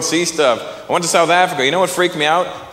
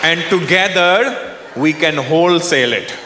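put-on Indian voice, long deliberate pauses; Indian negotiator. Raj proposes a deal to owner, deliberate Indian negotiator. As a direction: play a calmly scheming Indian businessman. Acted Indian worker bargains back.

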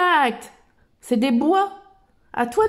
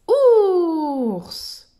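proud reveal; 5 semitones higher, 4 dB louder than usual. Shown as a demonstration. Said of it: "Un O".

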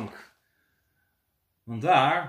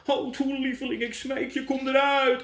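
pleading; 9 semitones higher than usual. Acted. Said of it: "klaagde de melkboer met tranen".

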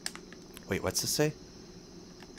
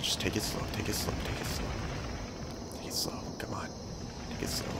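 much softer, near whisper; hushed; 2 semitones lower, 14 dB quieter than usual. Real, not acted. Player creeping forward, coaching himself in a hush. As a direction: coax yourself quietly, tense. Sneaking carefully, self-coaching.

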